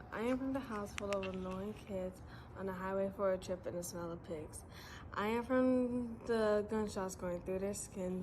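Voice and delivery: ordinary delivery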